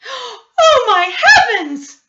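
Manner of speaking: high, loud horrified shriek of a frightened mother; shrieking cry. Acted. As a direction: scream it out in shock at the stripes. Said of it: "she cried".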